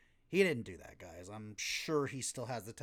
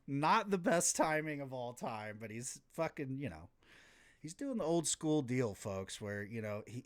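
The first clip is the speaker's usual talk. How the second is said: ordinary talk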